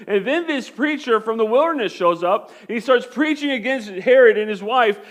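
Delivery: rising from plain to loud, high preaching; building preaching. Acted. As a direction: climb to full preaching force on not budging. Preaching builds to full force.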